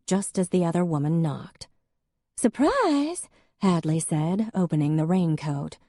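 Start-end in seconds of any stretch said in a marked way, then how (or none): 2.4-3.3 excited. A narrator's reading. Hadley arrives in a raincoat.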